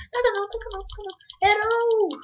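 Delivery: high, tense pleading then triumph; raging gamer. Real, not acted. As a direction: yell at the screen mid-fight. Fighting a witch in-game.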